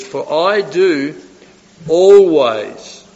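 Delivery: slow lead-in, then "always" punched loud; stress on "always". Acted. Preacher quoting Jesus to the congregation, stress on "always". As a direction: hit "always" hard and draw it out. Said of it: "I emphasise that".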